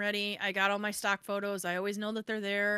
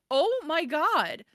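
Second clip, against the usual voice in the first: drawn-out, high and loud retold shock; flabbergasted; 8 semitones higher, 7 dB louder, much slower than usual. Author reacting to being told never to contact her, flabbergasted. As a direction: stretch it out slowly in high disbelief. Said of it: "I was just flabbergasted".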